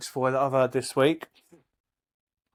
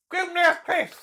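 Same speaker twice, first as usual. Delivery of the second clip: bad Irish accent, squealed. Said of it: "I'll try and do a bit of an Irish accent".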